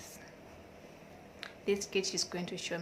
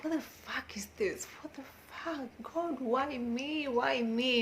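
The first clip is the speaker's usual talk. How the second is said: higher-pitched, drawn-out plea to God; exasperated lament; 5 semitones higher than usual. Retold or imitated. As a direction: re-enact a moment of despair. Acting out her despair at parenting.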